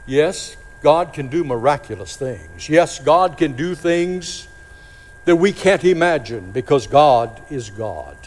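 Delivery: ordinary talk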